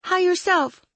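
high voice floating down; Claire. A narrator's reading. Claire replies from upstairs, high floating voice. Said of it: "The voice floated down".

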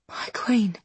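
soft unvoiced whisper. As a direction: whisper it with awe. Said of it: "Wren whispers".

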